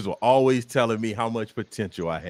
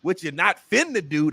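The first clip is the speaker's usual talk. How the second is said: "ain't" and "finna" said in a hammed-up country voice; country variants; 7 semitones higher, 4 dB louder than usual. Shown as a demonstration. Comedian listing country variants, playful and hammed-up. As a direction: ham up the backwoods versions. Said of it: "this is wrong, but it's, it's still right".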